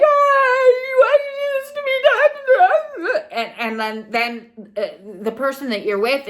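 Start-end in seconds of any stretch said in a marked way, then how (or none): 0.0-3.2 mock crying wail, very high and loud, slow; hysterical outburst. Person finally blowing up after repressing, mock wailing. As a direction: let the bottled-up feelings burst out in a hammed-up sobbing cry. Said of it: "and you're like".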